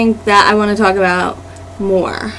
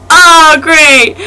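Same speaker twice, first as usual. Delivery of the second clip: sarcastic oh great; 5 semitones higher, 5 dB louder than usual. Retold or imitated. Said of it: "I'm like".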